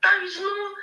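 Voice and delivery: emotional crack on a high note. Real, not acted. Nigerian speaker choking up over food prices. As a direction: near tears, voice breaking up. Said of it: "sometimes I get so emotional talking about this".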